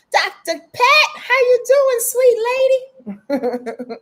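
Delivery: high, loud, drawn-out welcome; high excited greeting. Real, not acted. Livestream host greeting Doctor Pat in a high, delighted voice. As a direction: sing out a delighted hello to a friend. Greeting a viewer who joined the live.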